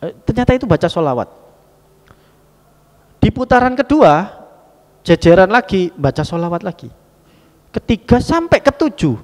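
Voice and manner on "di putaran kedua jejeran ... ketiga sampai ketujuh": loud and high, driving the count; rising preacher's call; emphatic preaching. Acted. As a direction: proclaim each round to the crowd. Preacher building the story.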